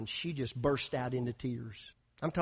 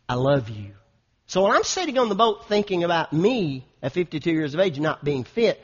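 loud, emphatic pulpit voice; full-force preaching; 8 dB louder than usual. Real, not acted. Pastor sharing his fitness resolve with his church, preaching loudly. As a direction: drive it home to the congregation.

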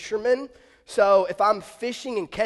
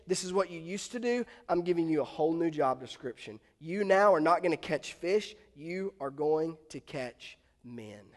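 low, soft, measured promise; quiet, steady voice of a reassuring teacher; calm reassurance; 6 semitones lower, 7 dB quieter than usual. Acted. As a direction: gently give a follower a new calling. Jesus reassures and commissions Peter.

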